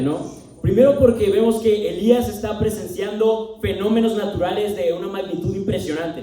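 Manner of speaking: ordinary delivery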